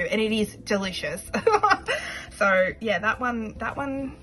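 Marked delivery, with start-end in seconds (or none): 1.3-2.3 high giggle. Real, not acted. Laughing after delicious.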